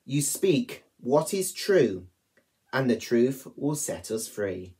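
ordinary talk, nothing marked

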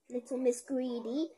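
plain reading voice

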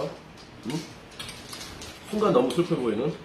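talking around food; words muffled by chewing; mouth full, chewing. Real, not acted. Seen 0: Puts kimchi in mouth at 0.1, then chews while speaking.

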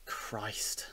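whispered under the breath; exasperated whisper. Acted. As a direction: breathe it out in disbelief. Exasperated at their nonsense.

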